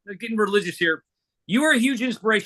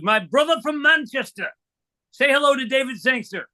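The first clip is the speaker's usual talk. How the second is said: hammed-up, higher-pitched Manchester accent; terrible Manchester accent; 5 semitones higher than usual. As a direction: put on a bad Manchester accent. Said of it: "That was a terrible accent".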